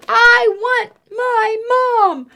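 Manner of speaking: slow, high, tearful wail; wailing boy. Acted. Eddie calling for Mom, slow tearful wail.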